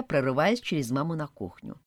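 ordinary delivery

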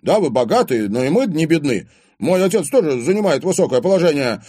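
loud, high, defensive argument; indignant. A narrator's reading. Boyfriend defends his father's standing, indignantly. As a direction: stand up for your family.

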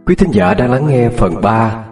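measured radio announcer read; announcer intro. Acted. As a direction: present the episode like a host. Channel intro over music.

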